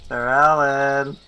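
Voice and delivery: sustained hail, short of a full yell; held-back yell. Acted. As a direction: call across a field, stretching the name out. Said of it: "I'm not yelling into my mic".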